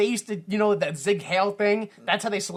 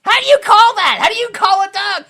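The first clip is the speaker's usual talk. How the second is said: loud, much higher yell; outraged shout; 13 dB louder than usual. Pretend. Ranting about silly dog names, loud outraged yell. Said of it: "outraged at dog names".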